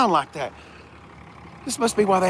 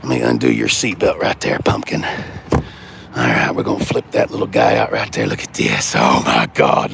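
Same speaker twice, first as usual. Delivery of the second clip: ordinary delivery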